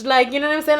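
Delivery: ordinary talk, nothing marked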